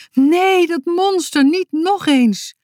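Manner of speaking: high, loud cry of dread; drawn-out horrified shriek. A narrator's reading. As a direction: cry out in alarm at a monster. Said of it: "Ineens gaf Frida een gil".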